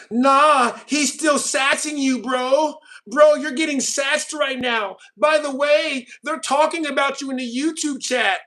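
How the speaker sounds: high, drawn-out mocking voice; mock taunting. Streamer mimicking chatters to Fletcher, high mock taunting. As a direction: taunt him like a gleeful chatter, stretched and high.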